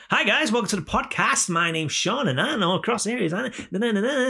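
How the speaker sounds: very high, hammed-up podcast intro; fake voice. Host mocking his own podcast opening, exaggerated fake voice. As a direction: overdo the fake host voice, tailing into gibberish. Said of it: "it's a fake voice".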